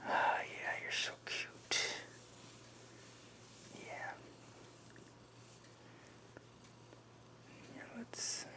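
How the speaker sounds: soft breathy whisper to a pet; whispering to cat. Real, not acted. Softly cooing to the cat.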